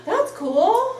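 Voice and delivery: gasping, high and amazed; surprised. Real, not acted. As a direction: react with a gasp of wonder. Gasp at Princess Diana news.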